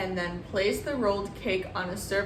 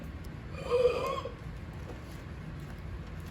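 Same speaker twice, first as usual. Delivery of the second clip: tense breathy gasp; 7 dB quieter than usual. Real, not acted. Flipping the cake.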